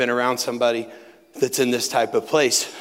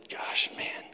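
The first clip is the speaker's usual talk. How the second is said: whispered sigh; 9 dB quieter than usual. Retold or imitated. Telling counselor about his dad.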